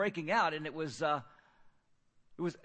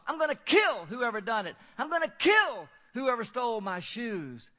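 loud, higher, raging threat; furious scream; 5 semitones higher, 6 dB louder than usual. Retold or imitated. Homeless man threatening the dining hall, loud furious yell. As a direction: bellow the threat at the whole room. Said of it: "screaming at the top of his lungs".

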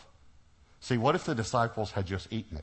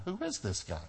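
high-pitched flustered inner voice; acted fluster, pitched well up; 4 semitones higher than usual. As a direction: puzzle it out in a rattled, raised pitch. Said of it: "I'm flustered".

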